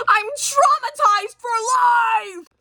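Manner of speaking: high, drawn-out dramatic cry; mock traumatized wail. Child who found parents' dirty texts, mock anguished wail. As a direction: wail it slowly in hammed-up horror.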